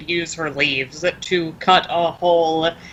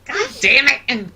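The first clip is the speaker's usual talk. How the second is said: frustrated outburst; 8 semitones higher than usual. Real, not acted. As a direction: spit out the annoyance. Player frustrated at failure.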